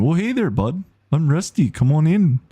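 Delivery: put-on high-pitched, chirpy Canadian accent. Streamer trying Rusty as a friendly Canadian, Canadian accent. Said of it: "so he could be Canadian".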